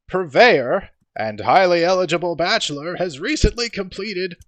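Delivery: high, loud trivia host; pompous showman quizmaster; Volo's game show voice. Acted. Volo hosts trivia.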